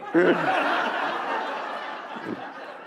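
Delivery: long, breathy, rising laugh; airy laugh. Real, not acted. Author laughing at his own punchline, long airy laugh.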